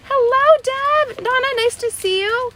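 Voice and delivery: very high-pitched, excited hello; sing-song greeting. Real, not acted. Host spots friends joining the livestream, high sing-song greeting. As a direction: greet them in a high, held squeal.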